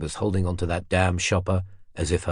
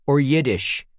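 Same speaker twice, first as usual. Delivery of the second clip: put-on curt, demanding American old man, pitched higher; peremptory American accent; 6 semitones higher, 4 dB louder than usual. A narrator's reading. Old man presses Ellie on the bus, curt American accent. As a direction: snap the question out in an American accent, not waiting. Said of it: "His tone was peremptory".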